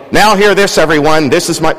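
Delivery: loud, high herald calling out "Now hear this"; proclamation. Acted. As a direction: announce it to the whole crowd. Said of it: "it's a proclamation".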